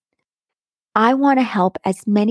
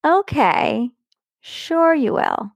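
higher, drawn-out mock agreement; condescending; 5 semitones higher, slower than usual. Sister doubting the podcast dream, condescending. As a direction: humour them slowly, imitating a patronising sister. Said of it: "said in a condescending voice".

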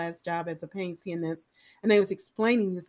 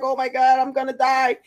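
imitating a panicking patient, a bit louder; mock gasping panic; a retold patient's scared cry; panicked patient; 5 semitones higher, 8 dB louder than usual. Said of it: "breathing so hard".

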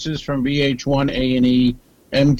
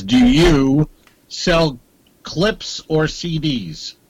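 slow reading; much slower than usual. Shown as a demonstration. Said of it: "It just says".

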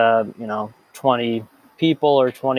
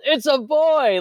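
high joyful shout; excited yell; 13 semitones higher, slower than usual. Retold or imitated. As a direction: yell it out like new dad. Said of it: "I yelled".

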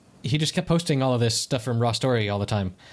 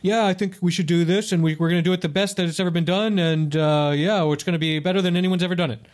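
higher, boastful mock Trump voice; Trump impression; 5 semitones higher than usual. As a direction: ham up a bragging politician. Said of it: "interviews with him where he's like".

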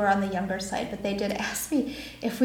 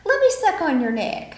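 1 Southern vampire; 7 semitones higher than usual. Pretend. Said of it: "You're like".

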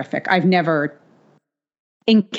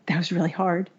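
exhausted murmur; 7 dB quieter than usual. Retold or imitated. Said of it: "we're like".

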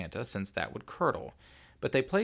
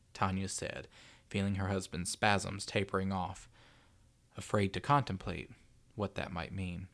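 plain reading voice

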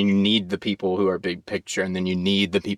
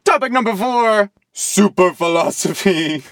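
announcer voice; 10 semitones higher, 4 dB louder than usual. Acted. Announcing the next topic.

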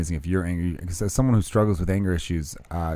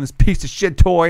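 slow, louder mock yell at the toy; retold angry yell; 7 semitones higher, 5 dB louder than usual. Dad retelling cursing the toy, slow mock yell. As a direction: bite each word out at the toy. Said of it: "I started yelling at that".

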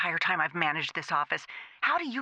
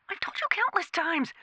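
higher, exasperated scolding; 10 semitones higher than usual. Acted. Manager to operator, exasperated high scold. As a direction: snap with exasperation.

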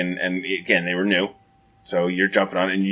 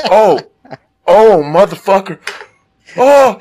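high, loud, drawn-out wailing; hammed-up howl of pain, slowly; mock agony; 9 semitones higher, 7 dB louder, slower than usual. Hurt kid clutching his eye, mock agonized wailing.

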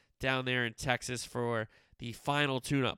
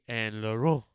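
Lorient said in a mock French accent, a bit louder; playful French accent on the team name; French accent attempt; 7 dB louder than usual. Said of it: "trying a French accent".

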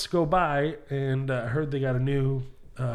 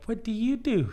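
put-on little kid; 8 semitones higher than usual. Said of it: "I was a little kid".

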